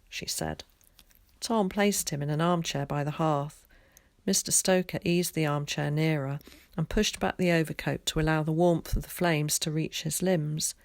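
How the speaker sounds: ordinary delivery, nothing marked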